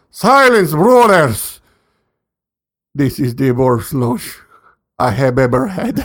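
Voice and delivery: hammed-up old movie character; old man. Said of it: "thinking about the old man in the scene".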